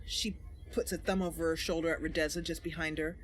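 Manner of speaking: low, soft and quick, tossed off as an aside; stage direction aside. A narrator's reading. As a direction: mutter the action text under your breath.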